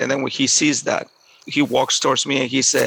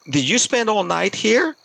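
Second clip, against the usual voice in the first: higher-pitched imitation of an incredulous question; retold astonishment; 8 semitones higher than usual. Stranger asks the boy about his night out, astonished.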